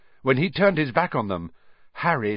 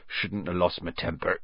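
rueful; 6 semitones lower, 7 dB quieter than usual. Acted. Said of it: "he said ruefully".

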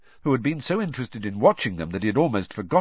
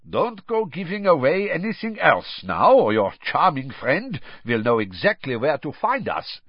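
higher, louder oily headmaster; a smooth, menacing tease; sly warning; 6 semitones higher, 4 dB louder than usual. Acted. Karkaroff voiced in audiobook.

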